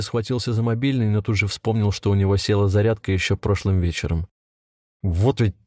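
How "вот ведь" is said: ordinary delivery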